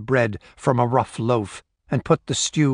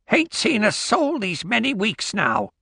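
put-on high old crone voice; old woman voice; 11 semitones higher than usual. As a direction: voice a suspicious old Scots woman. Old woman answers stranger.

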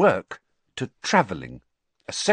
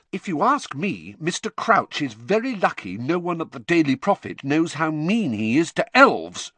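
angry; 8 semitones higher, 4 dB louder than usual. A narrator's reading. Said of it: "said Hermione angrily".

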